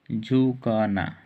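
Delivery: drawn-out झुकना, each sound stretched slowly; slow teaching. Shown as a demonstration. Teacher drawing out a word for students, slow. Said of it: "band का अर्थ क्या होता है".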